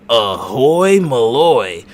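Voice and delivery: big booming Ahoy Malloy; loud show greeting. Acted. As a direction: belt it out like a show host. Opening shout of the show.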